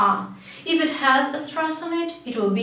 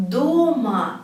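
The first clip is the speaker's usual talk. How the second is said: "doma" with a long, stressed "DO"; stress on first syllable. Shown as a demonstration. Teacher explaining "at home", "doma" stressed at the start. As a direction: stretch the first vowel of "doma". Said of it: "put the stress to the first syllable".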